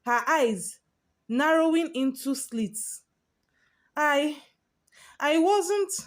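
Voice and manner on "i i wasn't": a faltering, stammered denial, pitched up on the second try; stammering, voice cracking. Acted. Vera stammering at the senior, voice cracking. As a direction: stumble over the words as if about to break. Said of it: "Vera stammered, her voice cracking".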